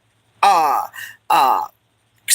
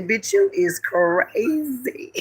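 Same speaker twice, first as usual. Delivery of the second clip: friend scoffing; 3 semitones higher, 5 dB quieter, much slower than usual. Retold or imitated. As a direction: drag it out in disbelief. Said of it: "My friend say".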